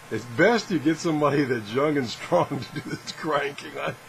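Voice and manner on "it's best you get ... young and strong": ordinary delivery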